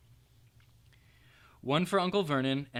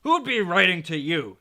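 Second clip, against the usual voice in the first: loud, higher sneer; 5 semitones higher, 10 dB louder than usual. A narrator's reading. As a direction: sneer down at the boy. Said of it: "sneered Uncle Vernon".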